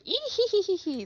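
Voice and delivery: high, quick "ee hee hee" henchman cackle; mock scheming giggle. Teasing a friend about playing gang boss's minion, mock high giggle. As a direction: put on a gleeful little minion titter. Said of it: "have your hands up by your face going".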